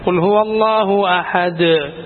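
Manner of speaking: slow, with the dal bouncing; അഹദ് closed on a bounced dal; echoing qalqala. Shown as a demonstration. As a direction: let the final dal of അഹദ് echo. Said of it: "എന്ന് തന്നെ കേൾക്കണം".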